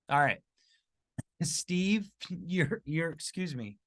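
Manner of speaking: choking on a drink; struggling through a choke. Real, not acted. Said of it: "I'm totally choking right in your ear".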